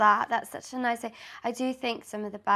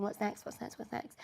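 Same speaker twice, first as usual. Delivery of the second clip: quiet repeated mutter; 12 dB quieter than usual. Retold or imitated. Mimicking restless inner voice.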